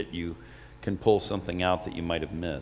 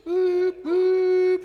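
much higher toy-horn boop; playful boop; 5 dB louder than usual. Pretend. Playful train-horn noise.

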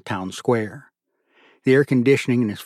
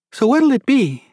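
high, loud waitress asking for the order; waitress taking an order; waitress mode; 13 semitones higher, 6 dB louder than usual. A narrator's reading. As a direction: chirp it like a waitress, pitched way up. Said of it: "reverting back to waitress mode".

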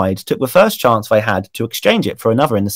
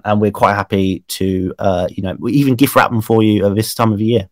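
ordinary talk

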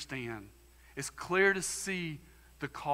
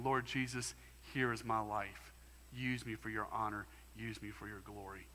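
softer, lower, prayerful surrender; quiet prayer; 5 semitones lower, 7 dB quieter than usual. Pretend. Believer praying surrender to Jesus, quiet prayer. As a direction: pray it softly, offering your life. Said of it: "and saying".

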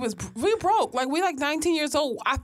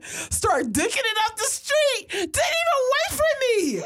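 excited; 11 semitones higher than usual. Retold or imitated. Retelling friend's sprint into traffic, excitedly building to a laughing shout.

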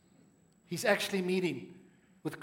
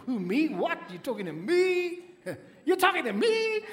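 incredulous American voice, climbing much higher with a laugh; American accent; 9 semitones higher, 3 dB louder than usual. Pretend. Preacher voicing Gideon's fright at being called, in an American accent. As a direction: play Gideon as a startled American, rising to a squeak. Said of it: "let me not get American now".

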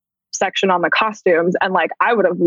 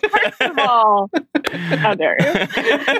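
laughing mock outrage; 5 semitones higher, much slower than usual.

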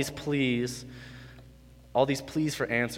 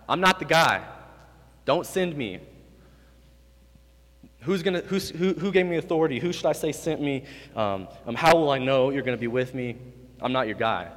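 voicing Moses; 4 semitones higher, 3 dB louder than usual. Preacher voices Moses pleading.

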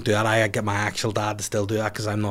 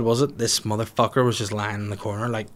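usual speaking voice

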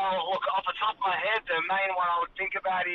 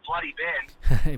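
grumbling curse; 3 semitones lower, 7 dB louder, much slower than usual. Real, not acted. Grumbling about host.

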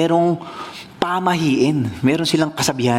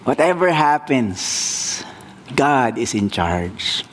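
solemn moral; 5 semitones lower, slower than usual. Real, not acted. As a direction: let the lesson sink in. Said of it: "moral stated slowly". (mabagal at taimtim na aral)

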